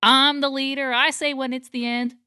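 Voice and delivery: emphatic self-appointed leader impression; imitating the bossy leader. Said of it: "And they're like".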